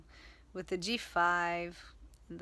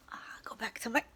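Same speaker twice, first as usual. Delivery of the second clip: whisper; 3 semitones higher, much faster than usual. Real, not acted. Whispered aside while moving.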